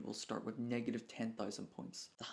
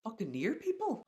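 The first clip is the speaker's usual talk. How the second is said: higher-pitched puzzled echo; startled disbelief; 10 semitones higher, 4 dB louder than usual. Real, not acted. Reader questioning an unfamiliar name from the manga, startled disbelief. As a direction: echo it back in surprise. Startled by what was read.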